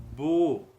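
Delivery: Bo said loud and clear; syllable demo. Shown as a demonstration. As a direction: call out Bo. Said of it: "se fosse com beit".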